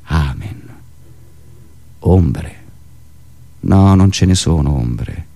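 ordinary delivery

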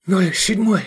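clipped call on the run; while running. A narrator's reading. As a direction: blurt it out mid-run. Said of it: "отрывисто сказал Эдик на бегу".

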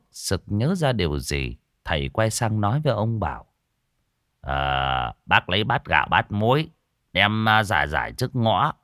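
ordinary delivery, nothing marked

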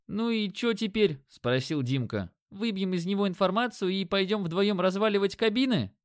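a light, cocky sidekick; young partner voice. A narrator's reading. Narrator voices Dimka.

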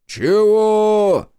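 very high, loud, long-held question; deafened shout. A narrator's reading. Deafened Shestakov asking Zotov to repeat, loud high shout. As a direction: yell it as if you can't hear yourself. Deafened man cannot hear.

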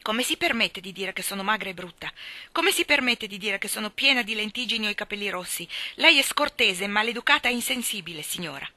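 put-on a child's furious rebuke; indignant tirade.

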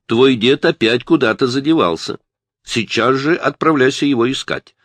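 a mother's insistent, projected command; mother calling out. A narrator's reading. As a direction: call across the house to your son. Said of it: "донёсшийся откуда-то из задней части дома".

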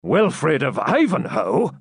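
high, drawn-out astonished question; slow, rising disbelief; shocked exclamation. A narrator's reading. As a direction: react in alarm to the name.

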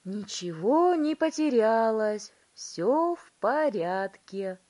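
loud, drawn-out jubilant announcement; shouting. A narrator's reading. Wonka reassuring the parents, shouting. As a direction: call it out loud and slow. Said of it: "закричал мистер Вонка".

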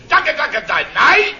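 high, loud yelled dog command; Koeltjes yelling at his dog. Retold or imitated. Imitating Koeltjes yelling at his dog, high and loud. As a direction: bark it out like a frantic owner calling off his dog. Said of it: "Tegen zijn helle hond antichristus".

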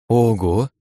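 a quick surprised wow. A narrator's reading. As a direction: caught off guard. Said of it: "удивился я".

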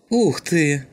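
high, delighted exclamation; exclaiming. A narrator's reading. Шум spots the magazine and grabs for it, exclaiming. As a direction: exclaim in eager wonder. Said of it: "воскликнул Шум".

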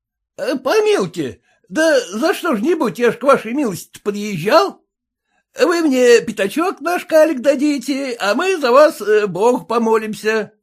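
high, wheedling peasant voice; insistent haggler, high-voiced; pleading peasant. A narrator's reading. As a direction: plead your case pitched well up.

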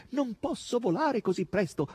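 high, plaintive boar voice; howled complaint. A narrator's reading. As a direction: protest in a high wail. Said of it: "ululò questi". (lamento ululato, voce acuta)

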